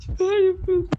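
high whiny mock cry; mock wail. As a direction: wail it like crying.

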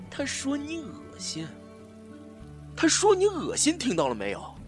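high, drawn out, building to a yell; hysterical taunt. Acted. (歇斯底里，越喊越高)

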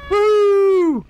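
high loud joyful whoop; sudden delighted yell; excited whoop. Real, not acted. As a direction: let out a big thrilled cheer. Excited cheer while playing.

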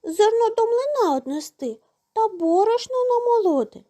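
high-pitched little mouse voice; sing-song high storybook mouse; squeaky mouse. A narrator's reading. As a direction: pipe it up like a tiny mouse. Mice voiced in a folktale.

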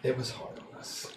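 very soft, near whisper; hushed confession. Acted. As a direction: confide it under your breath. Confiding hardship to wife in character.

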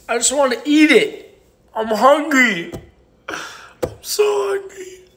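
high, drawn-out hammed-up whine; mock whining. Joking to viewers about being hungry, mock whining. Hamming up hunger over a gift.

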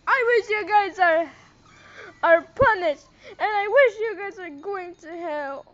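loud outburst of anger; angry shouting. Real, not acted. Vlogger cursing the haters, shouting angrily. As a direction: shout it at them like a curse.

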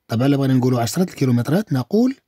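ordinary delivery

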